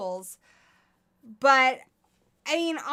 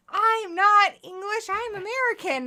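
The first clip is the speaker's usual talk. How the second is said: put-on drunk protest; 10 semitones higher, 7 dB louder than usual. Retelling own drunken reply to someone, loud mock protest. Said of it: "When I'm very drunk".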